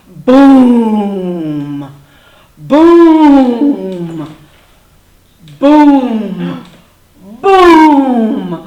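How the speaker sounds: loud, long, spaced-out booms; booming footsteps. A narrator's reading. Little dinosaur hears someone coming, heavy resounding booms. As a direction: resound each approaching giant step. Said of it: "little dinosaur heard".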